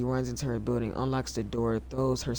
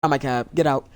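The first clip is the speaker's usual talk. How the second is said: loud reading that gets louder toward "Get out"; screaming; 9 dB louder, faster than usual. Acted. Streamer voices the passenger yelling at her lover's wife, loud. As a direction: shout the passenger's line, building. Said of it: "she's almost scream".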